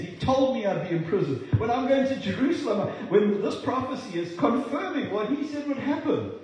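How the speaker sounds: high, forceful insistence; exasperated rebuke. Acted. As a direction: push back passionately at worried friends. Paul rebukes his pleading friends, acted.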